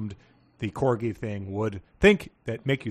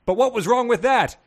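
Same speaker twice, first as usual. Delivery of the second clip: indignant question; 11 semitones higher, 8 dB louder than usual. Acted.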